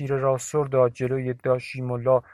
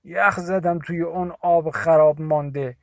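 husky, higher and louder complaint; hoarse voice; 4 semitones higher, 5 dB louder than usual. A narrator's reading. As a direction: croak it out, frozen to the bone. (با صدای گرفته و لرزان)

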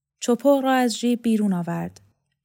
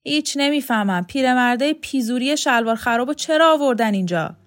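louder, higher contemptuous complaint; angry rude neighbour; 5 semitones higher, 4 dB louder than usual. Acted. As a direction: rant about the useless old folk. Rude neighbour rants about old men.

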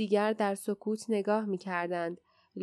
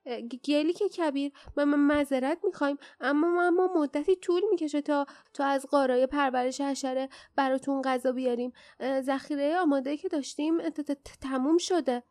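high, frightened stutter; nervous, high-pitched old servant tripping over words; stammering old dwarf; 5 semitones higher, 3 dB louder than usual. A narrator's reading. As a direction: stammer a timid apology to a fearsome master. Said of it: "با لکنت گفت".

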